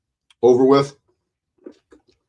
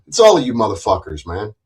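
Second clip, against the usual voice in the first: usual speaking voice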